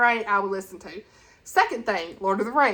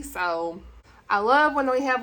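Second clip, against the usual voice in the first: usual speaking voice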